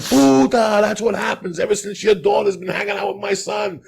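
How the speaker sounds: loud, high furious mother yelling; angry retold yelling. Mother screaming at the neighbor about her daughter, loud and angry. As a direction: tear into the neighbor at full volume.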